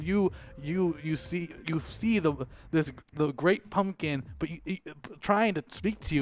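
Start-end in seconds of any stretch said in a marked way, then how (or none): none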